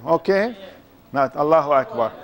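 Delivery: usual speaking voice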